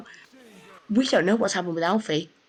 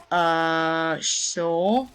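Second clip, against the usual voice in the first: in that voice; 3 semitones lower than usual. Retold or imitated. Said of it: "Like, in that voice".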